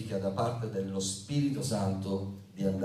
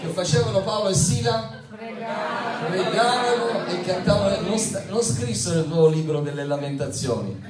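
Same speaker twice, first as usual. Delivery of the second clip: loud, high-pitched, forceful proclamation; impassioned preaching; 8 semitones higher, 6 dB louder than usual. Real, not acted. Preacher rousing congregation over Paul and Silas praying, impassioned and loud.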